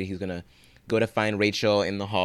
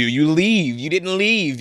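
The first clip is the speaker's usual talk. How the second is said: raised, higher, louder scolding; exasperated scolding; 8 semitones higher, 8 dB louder, slower than usual. Acted. Host scolding the TV character, exasperated and loud. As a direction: tell her off through the screen.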